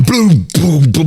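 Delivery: punchy mouth-drum thumps; imitated drum hits. Fan mimicking [name]'s drumming, punchy imitated hits. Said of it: "[name] and his bloody drums".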